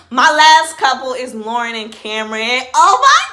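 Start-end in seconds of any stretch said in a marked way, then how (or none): 2.8-3.3 high, loud delighted squeal; excited squeal. Real, not acted. Gushing about her favorite couple, excited squeal. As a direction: squeal with joy.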